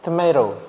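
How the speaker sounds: tomato as "tuh-MAY-ro"; American pronunciation. Shown as a demonstration. Teacher showing how Americans say it, American tomato "tuh-MAY-ro". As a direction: flap the second t of tomato, stressed MAY. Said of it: "Orang Amerika itu ngucapinnya tomato".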